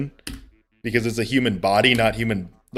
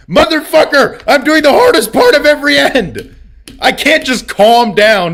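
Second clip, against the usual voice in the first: much higher and louder, exasperated yell; mock outraged yell; 8 dB louder than usual. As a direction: blow up at chat in mock fury. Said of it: "angry reply to chat".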